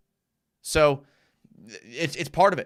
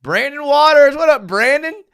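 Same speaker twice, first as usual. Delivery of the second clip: much higher and louder greeting; excited shout-out; 12 semitones higher, 9 dB louder than usual. Real, not acted. Greeting viewer Brandon, loud excited shout-out. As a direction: call out to a friend across the room.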